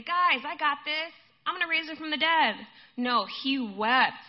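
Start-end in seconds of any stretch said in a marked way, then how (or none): 0.0-2.7 loud, high, cocky announcement; mock confidence. Imagined Jesus reassures mourners before the miracle, mock confidence. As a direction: announce it breezily, as if no problem.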